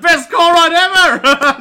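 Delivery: slow, high, loud ecstatic yell; loud, high, drawn-out joyful cheer for the road trip; overjoyed shout. Acted. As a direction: belt it out like the happiest man alive. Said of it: "He's just like".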